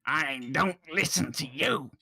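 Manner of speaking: kobold refusing the whispered spell, high creature voice; high, drawn-out squawk of protest; angry kobold. Acted. Kobold resisting words whispered into its ear, indignant creature voice. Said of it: "it goes".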